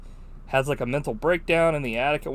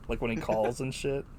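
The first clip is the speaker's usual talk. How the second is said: normal talking voice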